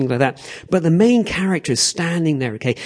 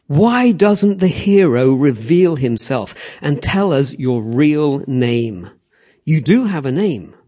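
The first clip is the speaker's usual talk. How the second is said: slow, deliberate imperial demand; emperor; 4 dB louder, slower than usual. Acted. Emperor addressing the victorious gladiator in the arena, slow and commanding. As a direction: address the gladiator slowly, weighing each word. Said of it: "the emperor says this".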